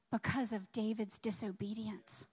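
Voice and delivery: hushed whisper on the tragedy. Acted. Preacher whispers the cost of disobedience to the church. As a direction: let it sink in with a whisper. Grave point in sermon.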